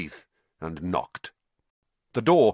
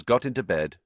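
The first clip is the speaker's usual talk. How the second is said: ordinary delivery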